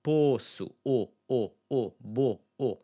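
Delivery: "poço" as "POH-soo", then isolated "o"; rounded oh, repeated separately; closed stressed O. Shown as a demonstration. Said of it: "this closed stress sound".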